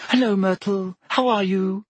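bright, high, coaxing hello; sweet high greeting. A narrator's reading. Hermione greets the ghost.

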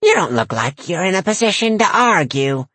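high, smug granny voice; smirking old lady. A narrator's reading. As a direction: smirk it, knowing she wins. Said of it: "the old lady smirked".

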